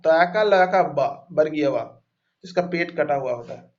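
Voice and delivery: ordinary delivery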